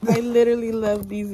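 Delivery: usual speaking voice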